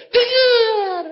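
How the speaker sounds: high, loud, drawn-out answer; whining reply. Retold or imitated. Questioned man admitting he heard, high drawn-out whine. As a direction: stretch it into a comic whine. Man's acted sheepish answer.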